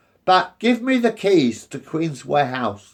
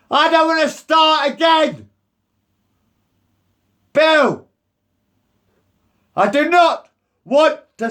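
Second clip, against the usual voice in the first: raised distress; 7 semitones higher than usual. Real, not acted. Pleading not to move.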